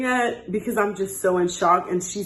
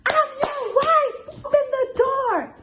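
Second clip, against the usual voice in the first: imitated scream. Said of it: "this lady and she screams".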